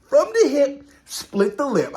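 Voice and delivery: hyped reaction. Retold or imitated. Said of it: "I was like".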